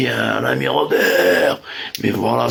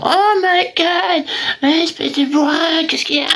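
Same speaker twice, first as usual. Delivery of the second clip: mock woman's voice. [name] mimics a woman scolding him, falsetto. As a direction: squeal it like an offended lady. Women reacting, falsetto quote.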